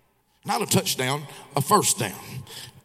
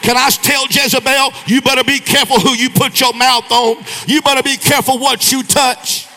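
loud, higher, driving preacher cadence; full-force preaching; 6 semitones higher, 9 dB louder than usual. Acted. Preacher warning the church about Jezebel, preaching at full force. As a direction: preach it hard at the congregation.

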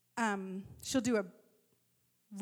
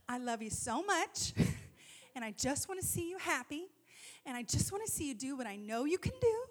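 softer, put-on patient mom voice; bringing it back; 4 dB quieter than usual. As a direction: rein it in and reassure her. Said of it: "let me bring it back".

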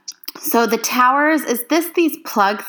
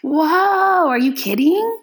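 real disbelief at the numbers; gasping whoa, are you kidding; amazed; 8 semitones higher, slower than usual. Real, not acted. Amazed by the water figures.

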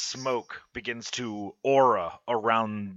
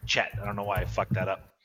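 ordinary talk, nothing marked

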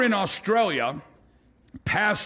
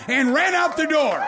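excited climax; 6 semitones higher, 3 dB louder than usual. Real, not acted. Climax of the retold story.

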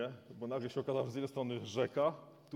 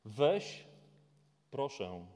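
louder call of God, take your son; commanding call; 7 dB louder, much slower than usual. Acted. God calling Abraham to sacrifice his son, commanding. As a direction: voice God's word with authority. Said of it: "Nie przychodzi z nakazem".

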